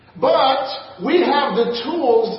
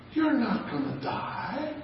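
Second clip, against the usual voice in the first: soft, calm and softer, reassuring the lie; 11 dB quieter than usual. Retold or imitated. Serpent soothing Eve, soft calm voice. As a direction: coax her gently as the serpent. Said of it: "He said it in such a calm voice".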